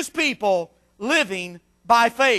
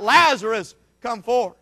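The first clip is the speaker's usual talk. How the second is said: normal talking voice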